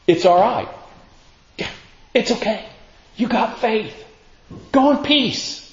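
gentle reassurance. Acted. Jesus telling a frightened woman it's okay, gently reassuring. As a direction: soothe tenderly in a high, light voice.

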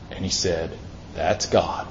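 low, very soft realization; hushed retelling. Retold or imitated. Preacher voicing the prophet recognizing God, hushed. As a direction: let it land quietly, almost under the breath. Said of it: "still, small voice".

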